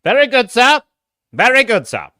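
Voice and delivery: high, loud officer's reply; captain's acknowledgement. Pretend. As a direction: sing out the acknowledgement to the crew. Answers chat as ship's captain.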